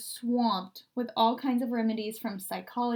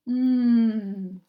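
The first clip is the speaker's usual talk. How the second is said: delighted mmm; 8 dB louder than usual. Acted. Tasting beans.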